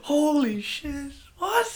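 high, drawn-out amazement; shocked. Real, not acted. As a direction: stretch it out slowly, stunned. Shocked it has been four years.

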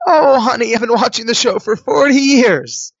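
high, loud, doting granny voice; old lady. Retold or imitated. Old lady pinches the young contestant's cheeks, high doting voice. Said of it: "There was this old lady sitting next to me".

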